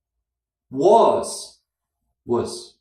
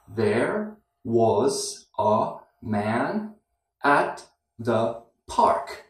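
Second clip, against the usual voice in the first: very slow, every word separate; slow and clear; 5 semitones lower, 3 dB quieter, much slower than usual. Shown as a demonstration. Teacher reading the sentence to students, very slow and clear. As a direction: break the sentence down word by word, slowly. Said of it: "I spoke very clearly and slowly".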